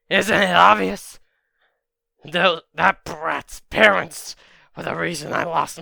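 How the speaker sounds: loud, heated villain outburst; acted bitter shout; angry villain retort. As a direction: spit out the grudge loudly. Villain angrily explains his grudge.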